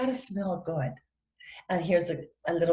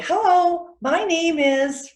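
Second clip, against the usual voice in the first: high, loud, slow model greeting; clear introduction; 9 semitones higher, 4 dB louder, slower than usual. Shown as a demonstration. Teacher modelling how we say our names, clear. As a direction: announce yourself clearly, drawing it out. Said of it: "saying our name clearly".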